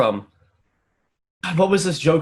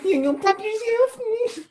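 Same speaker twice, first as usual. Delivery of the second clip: put-on squeaky, very high anime girl; laughing, high cutesy anime voice; 3 dB quieter, slower than usual. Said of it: "I hate the anime girl voice that you do".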